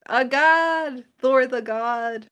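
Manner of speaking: loud, high, stretched-out exclamation; excited hype. Real, not acted. Host hyping Thor to her co-host, excited cheer. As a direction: hype it up, drawing the words out. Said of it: "we're excited to".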